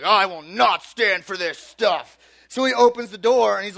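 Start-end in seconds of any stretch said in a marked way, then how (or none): 0.0-2.2 slow, loud mock-cop voice; hammed-up officer stressing each word; stern cop. Neighbour cop refusing to tolerate pranks, stern and slow. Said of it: "He is like your cop".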